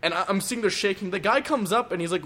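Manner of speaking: ordinary talk, nothing marked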